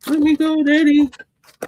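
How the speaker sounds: high, loud mock plea; pleading pet voice. Voicing the cat begging Daddy for release, mock pleading.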